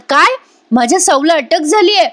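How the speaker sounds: retold shock, pitched high; shocked questioning. Madhubhau overhearing his Sau's arrest, shocked questioning. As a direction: voice a stunned father's disbelieving question.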